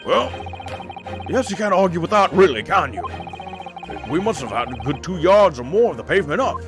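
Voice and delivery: workman juror voice. Acted. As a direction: insist like a proud labourer. Juror defends his roadwork.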